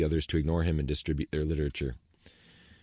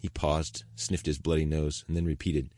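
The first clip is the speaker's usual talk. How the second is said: ordinary delivery, nothing marked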